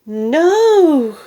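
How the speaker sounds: long, high, loud cry of horror. A narrator's reading. Two onlookers seeing grandma drink, loud horrified cry. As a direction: shout it out in panic. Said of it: "the other two cried".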